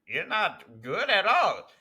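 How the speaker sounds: high, slow, smarmy put-down; mock a sleazy casting boss; sleazy producer voice. Mocking the casting director.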